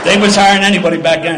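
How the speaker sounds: loud laughing punchline. Real, not acted. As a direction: laugh out the joke.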